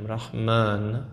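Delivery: رحمن with the last vowel held long; drawn-out a. Shown as a demonstration. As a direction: open front A in رحمن, stretched.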